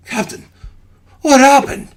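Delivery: alarmed confusion. Acted. Transformed man confused.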